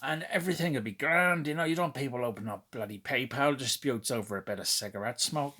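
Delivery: playful put-on Northern Irish accent. Reviewer advising seller Willie about smoky figures, in a mock Northern Irish accent. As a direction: hand out friendly advice in Northern Irish. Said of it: "that's my impression of, uh, Northern Ireland accent".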